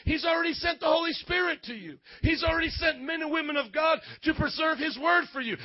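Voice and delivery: high, impassioned preacher's scolding; indignant rebuke. Real, not acted. Preacher rebuking those who challenge God, indignant and impassioned. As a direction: confront them with rising, forceful indignation.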